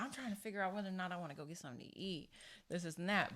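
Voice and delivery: fast, soft mumble; evasive dad. Retold or imitated. Dad dodges his daughter's question, fast and soft. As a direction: rush it out under your breath. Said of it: "he's like".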